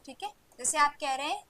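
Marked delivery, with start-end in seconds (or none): none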